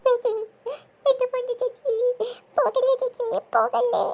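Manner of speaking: crying out for a sister; tearful pleading. Acted. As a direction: plead through hammed-up sobs. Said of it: "ആ കൊച്ചിനെ കരയിപ്പിച്ചു".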